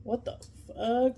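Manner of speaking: slow, drawn-out, muted bewilderment; dazed disbelief. Retold or imitated. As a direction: stretch it out as if unsure it happened. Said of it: "I was just like".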